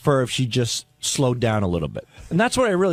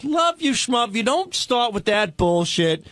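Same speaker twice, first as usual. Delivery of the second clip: higher-pitched mock telling-off; retold scolding; 7 semitones higher, slower than usual. [name] retelling Robin's rebuke in the hallway, scolding. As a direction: imitate her cutting him off in irritation. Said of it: "she really laced into me".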